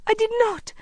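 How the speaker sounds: put-on very high, breathless boy's protest; gasped denial. A narrator's reading. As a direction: gasp out a desperate denial. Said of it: "he gasped".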